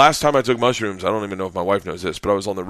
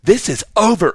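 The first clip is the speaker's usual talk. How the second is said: high, loud teacher's outburst; retold yelling; 10 semitones higher, 5 dB louder than usual. Teacher storming into students' cabin, angry yell. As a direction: announce it sharply, pitch raised. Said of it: "starts yelling at me".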